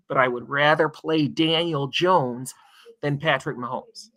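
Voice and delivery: usual speaking voice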